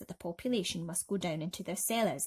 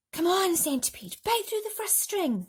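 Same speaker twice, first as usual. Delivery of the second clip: James barking an order, higher and louder; 11 semitones higher, 4 dB louder than usual. A narrator's reading. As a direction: give the order briskly, pitched up. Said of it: "James ordered".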